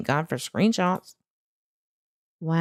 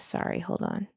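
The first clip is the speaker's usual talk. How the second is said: whisper; 7 dB quieter than usual. Real, not acted. Apologising to viewers mid-search.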